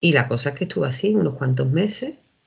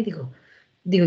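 usual speaking voice

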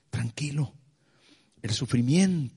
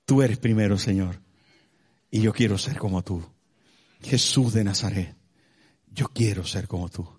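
earnest prayer; 4 semitones lower than usual. Acted. Pastor praying before the congregation, low and devoted.

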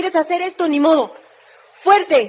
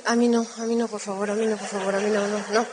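soft, hushed mock plea, much quieter; pleading quietly; 6 semitones lower, 6 dB quieter than usual. Audience member begging the speaker not to pick them, hushed mock plea. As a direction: shrink away and beg not to be chosen.

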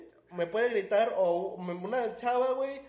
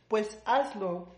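desafío retado; 3 semitones higher, much slower than usual. Retold or imitated. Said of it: "Le dije".

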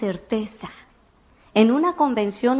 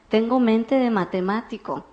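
ordinary talk

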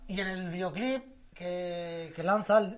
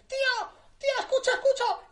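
put-on very high squealing girl voice; high mock party-girl squeal; imitating excited girls. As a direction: mimic girls at a disco begging. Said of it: "las chicas ... dicen".

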